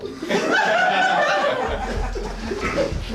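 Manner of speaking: high-pitched sinister giggle; creepy high clown laugh. Acted. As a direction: cackle like a horror clown.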